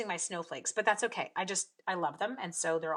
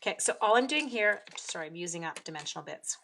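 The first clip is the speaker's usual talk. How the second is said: ordinary delivery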